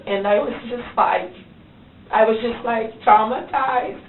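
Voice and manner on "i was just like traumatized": normal talking voice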